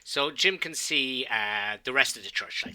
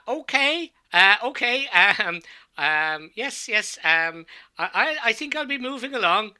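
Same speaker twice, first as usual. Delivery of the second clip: high, raised, hesitant voice; flustered older priest; 10 semitones higher, 5 dB louder than usual. Acted. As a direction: make awkward excuses in a high, loud fluster. Older priest awkwardly excusing himself.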